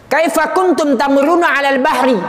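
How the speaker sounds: high, projected question; Jibril. Acted. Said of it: "Malaikat Jibril ngomong".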